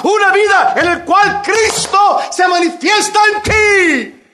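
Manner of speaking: builds from loud to a high ringing shout; shouted preaching. Acted. Preacher exhorting the congregation, climbing to a full shout. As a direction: climb each una vida until you cry out.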